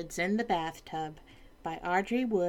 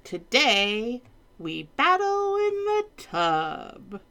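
slow, higher, proud king; grand king voice; 5 semitones higher, 4 dB louder than usual. Acted. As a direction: announce it grandly and slowly. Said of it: "cried the king".

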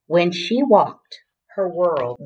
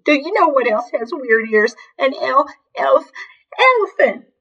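weepy wail; 9 semitones higher than usual. A narrator's reading. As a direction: force the word out through weeps. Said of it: "Weeps and all".

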